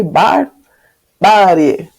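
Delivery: "barbárie" drawn out very slowly, stress on the second ba; syllable by syllable. Shown as a demonstration. Teacher to students, slow stress on barbárie. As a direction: spell out "barbárie" slowly, leaning on bár. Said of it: "Temos o primeiro ba".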